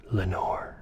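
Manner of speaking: breathy whisper of a lost name. A narrator's reading. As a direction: whisper it like a secret. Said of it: "This I whispered".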